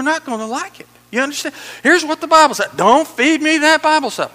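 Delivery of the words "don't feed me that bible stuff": high, loud mock protest; defiant refusal. Preacher acting out someone rejecting the Bible, defiant. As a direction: push it away, loudly.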